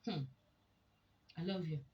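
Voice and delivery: brief, offhand declaration of love; dutiful, indifferent. Shown as a demonstration. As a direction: say it to get it over with. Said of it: "I have said it, and so what?".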